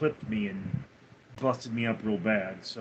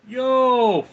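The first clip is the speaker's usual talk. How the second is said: shouted yo, high and loud; 10 semitones higher, 6 dB louder than usual. Acted. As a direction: yell it out. Loud alert greeting.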